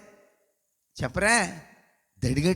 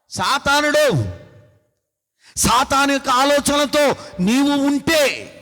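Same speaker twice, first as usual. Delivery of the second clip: fiery preaching; 5 semitones higher, 4 dB louder than usual. Acted. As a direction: warn the congregation with fire. Preaching at full force.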